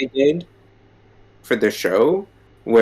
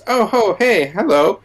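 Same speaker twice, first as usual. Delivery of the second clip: high, chirpy mock-friendly hello; Steven's greeting; 10 semitones higher than usual. Imitating Steven popping up with a high bright hello. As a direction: pop up all cheery and awkward. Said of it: "Steven pops up and goes".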